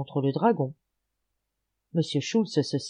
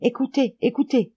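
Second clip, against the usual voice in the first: alarmed call; 7 semitones higher, 4 dB louder than usual. A narrator's reading. Alarmed warning to companions.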